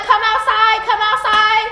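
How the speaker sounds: loud and high, come outside; calling her out. Real, not acted. Pregnant woman yelling for the girlfriend to come out, loud call. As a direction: yell it up at the house, daring her out. Pulling up to rival's house.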